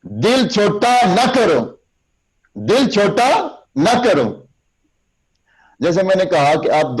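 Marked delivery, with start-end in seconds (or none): 0.0-4.5 high, slow, drawn-out encouragement; slow rousing oratory; encouraging exhortation. Shown as a demonstration. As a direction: lift their spirits slowly. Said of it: "دیکھیں".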